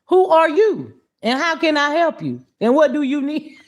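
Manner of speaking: high, sharp demanding questions; sassy demanding. Retold or imitated. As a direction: throw the questions back at the caller. Mocking the collector's demands.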